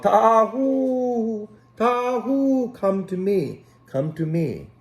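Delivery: high, carrying call across the hills; shepherd calling his sheep by name. Acted. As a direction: call the lost sheep from far off. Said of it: "He called".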